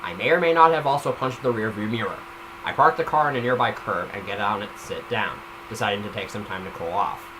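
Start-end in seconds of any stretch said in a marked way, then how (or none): none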